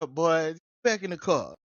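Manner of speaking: ordinary delivery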